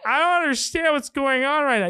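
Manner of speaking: high, bewildered dorky voice; confused cop with triple glasses, high and baffled; confused nerd cop. Retold or imitated. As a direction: sound totally lost. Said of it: "Just like".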